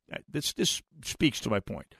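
usual speaking voice

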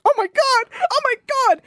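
high, rising panic; panicked. Retold or imitated. Guest panicking as phones are banned, high mock panic. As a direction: squeal it in a mild mock panic. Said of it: "And I'm like".

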